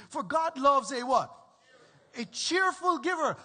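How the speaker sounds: loud, high, rousing sermon delivery; preaching at full force. Acted. Preacher reading Paul to the church, loud and high. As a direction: lift the congregation with it.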